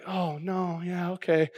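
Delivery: the wife answering her husband's half-marathon plan, hushed and hesitant; wife's hesitant hushed reply. Retold or imitated. Hushed reluctant okay. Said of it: "She's like".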